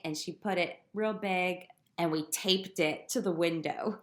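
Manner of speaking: ordinary talk, nothing marked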